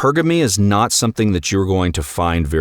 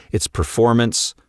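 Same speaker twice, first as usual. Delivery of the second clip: ordinary talk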